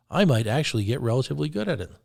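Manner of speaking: ordinary talk, nothing marked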